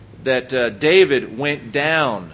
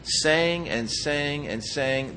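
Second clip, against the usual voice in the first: softer, slow saying and saying and saying; repetitive emphasis; 6 dB quieter, much slower than usual. Shown as a demonstration. Preacher showing a repeated tense, slow soft repetition. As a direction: draw out saying and saying and saying to show repetition. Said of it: "it's as if it's".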